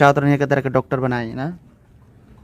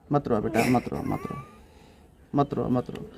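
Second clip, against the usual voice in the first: soothing comfort; 5 semitones lower, 11 dB quieter than usual. Real, not acted. Reporter comforts crying girl.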